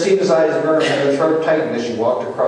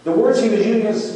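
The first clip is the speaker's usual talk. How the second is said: ordinary talk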